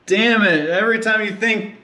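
real annoyance while pulling the engine head; frustrated. Real, not acted. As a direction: vent at the endless parts.

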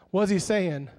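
ordinary delivery

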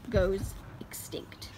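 low, slow dramatic whisper. Acted. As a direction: whisper the grim ending. Dramatic documentary closer.

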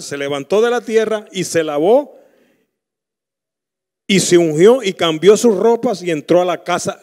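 loud, rising preacher voice; fiery sermon delivery; preaching at full force. Acted. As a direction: drive the story home from the pulpit. Preaches the David story loudly.

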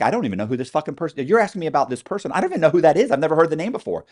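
a hint of outraged inmate, quick and loud; exasperated protest. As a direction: rattle it off in disbelief, voice rising. Imagined protest to the agents.

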